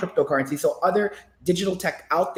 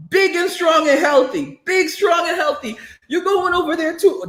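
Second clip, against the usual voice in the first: much higher excited teasing; teasing hype; 9 semitones higher than usual. Pretend. Teasing Jerron that he's big enough for the draft. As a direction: hype him up mockingly.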